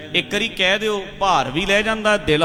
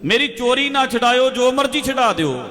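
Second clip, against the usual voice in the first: pleading thief; 7 semitones higher than usual. Retold or imitated. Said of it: "ਇਹਨੂੰ ਇਹ ਕਹਿੰਦਾ".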